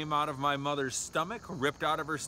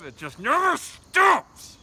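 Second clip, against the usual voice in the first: slow, high, furious yell; furious shout; 13 semitones higher, 10 dB louder, slower than usual. Real, not acted. Ranting about school and jobs, furious shout. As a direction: blow up at the endless grind.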